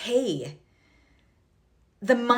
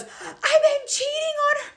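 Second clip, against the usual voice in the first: high, tearful blurted confession, laughing through tears; a cousin's sudden tearful confession; bursting out, half laughing half crying. Retold or imitated. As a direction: blurt it out between laugh and sob, voice high and cracking. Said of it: "She bursts out and goes".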